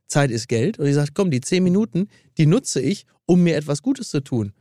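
scripted sponsor read. Acted. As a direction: read it like an ad spot. Reads an ad.

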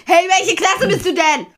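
mock shout at the boy; retold shout. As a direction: call out to the boy as if across a playground. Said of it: "ich wollte ihn anschreien".

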